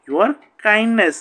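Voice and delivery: very slow, stretched out; careful slow model phrase; slow drill. Shown as a demonstration. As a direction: draw out Your kindness. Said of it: "drill: your kindness, repeated".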